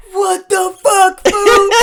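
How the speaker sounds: a put-on yell of disbelief; mock shocked yell. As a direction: blurt it out in disbelief. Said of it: "and I was like".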